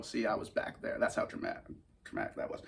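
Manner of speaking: breathy hush; whispered. Real, not acted. Recalling the dramatic moment, whispered.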